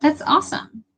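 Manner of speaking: ordinary talk, nothing marked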